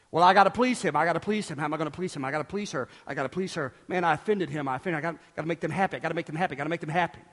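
low, soft, fretful muttering; worried pastor muttering to himself; anxious people-pleaser. Acted. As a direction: fret under your breath in an endless loop. Acts out a people-pleasing pastor fretting.

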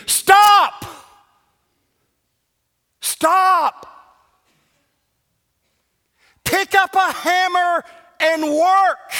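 loud, high preaching shout; shouted command. Real, not acted. Pastor ordering complacent members to stop, shouting. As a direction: thunder it from the pulpit.

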